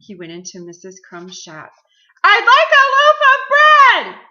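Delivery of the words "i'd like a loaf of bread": booming shout. A narrator's reading. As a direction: boom it out at full volume. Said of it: "he boomed".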